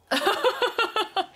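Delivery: high, quiet run of chuckles; laughing. Real, not acted. Host amused by the old song, high soft laugh. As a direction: chuckle in a rapid high string.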